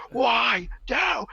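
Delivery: very high mock scream, held back in volume; imitated scream. Retelling the singer's mad ending, imitated scream. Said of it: "like, he's screaming it".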